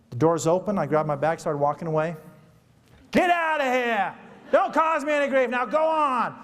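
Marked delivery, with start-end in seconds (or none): 3.1-6.4 high, loud barking yell from the driver; retold gruff shout. Driver shouting his passenger out of the van, loud high yell. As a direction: shove him out the door with a yell.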